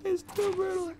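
very high, thin whimpering wail; mock crying. Player overwhelmed by gore, high whimpering squeak.

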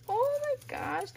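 high-pitched delighted gush; amazed, high-pitched admiration; delighted surprise. Real, not acted. Delighted at first sight of gift.

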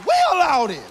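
loud, high, rhythmic preacher's climax; full-force preaching. Real, not acted. Preacher rousing the congregation, rising shouted cadence. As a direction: drive it home like a revival crescendo.

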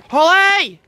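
loud high call to a stuck dog. Real, not acted. Calling her dog from across the field, loud and high. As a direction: shout the dog's name across the field.

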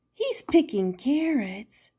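ordinary delivery, nothing marked

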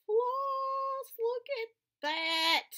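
high, drawn-out delighted gush; excited reveal. Real, not acted. Vlogger showing viewers her gifted floss, excited reveal. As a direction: draw it out high, savoring the reveal.

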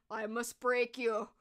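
slow, badly faked Russian accent; drawn-out mock Russian accent. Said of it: "the Russian accent".

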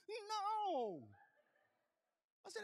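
high pained whine; high-pitched wounded no; whiny hurt reply. Retold or imitated. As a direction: whimper it like a hurt child. Retold himself in pain.

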